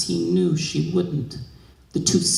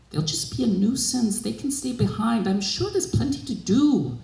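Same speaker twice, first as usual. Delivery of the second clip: higher, grumbling dismissive voice; an old mistress grouching at her maid, pitched up; grouchy mistress; 7 semitones higher than usual. A narrator's reading. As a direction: brush the request off with a grumble. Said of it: "Bibi Safia grouched".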